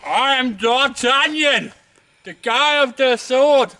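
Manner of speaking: high, booming, drawn-out mock-heroic shout; D'Artagnan, very loud. Hiker proclaims himself a swordsman on the high point, very loud. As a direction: shout a slow, high-pitched swashbuckler's proclamation. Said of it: "That was incredibly loud".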